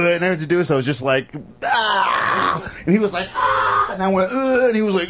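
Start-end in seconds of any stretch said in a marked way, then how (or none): 1.6-2.6 mock yell. Face-off with other driver. 3.3-3.9 mock yell back. Other driver yells back. 4.3-4.6 mock grunt. Grunting contest in traffic.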